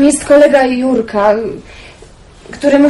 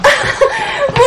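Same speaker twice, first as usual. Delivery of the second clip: high, bright burst of laughter; joyful laugh; 13 semitones higher than usual. Acted. Basia laughs in relief when her aunt agrees, joyfully.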